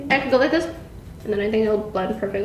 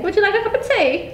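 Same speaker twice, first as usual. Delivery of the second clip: posh British accent, higher; British accent demo; 7 semitones higher than usual. Shown as a demonstration. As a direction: model the proper British cup of tea. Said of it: "You said that so wrong".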